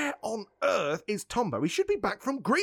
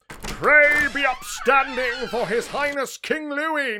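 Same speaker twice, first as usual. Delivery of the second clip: raised, ceremonial herald's call; court announcer; 5 semitones higher, 4 dB louder than usual. Acted. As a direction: announce royalty, then correct yourself. Announces another king's entrance.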